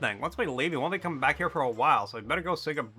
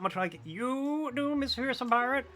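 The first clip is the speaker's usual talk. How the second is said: high bird voice breaking into squawks; a chatty bird gossiping to the pirate; talking bird postman; 7 semitones higher than usual. Acted.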